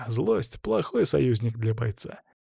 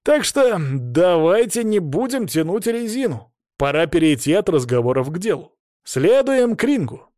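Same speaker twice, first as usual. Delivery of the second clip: rally everyone towards the ring; big, stretched-out host announcement; rousing call; 6 semitones higher, 4 dB louder than usual. A narrator's reading. Host sends fighters to ring.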